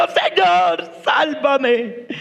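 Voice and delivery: desperate cry for help, voice strained; grito de auxilio. Acted. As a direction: cry out as if sinking. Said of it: "dio a voces diciendo". (grito actuado de socorro)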